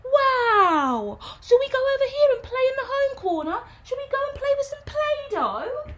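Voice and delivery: very high, bright sing-song nursery teacher; high sing-song voice for a classroom of small children. Shown as a demonstration. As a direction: gush like a teacher praising a toddler. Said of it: "If I'm talking to children".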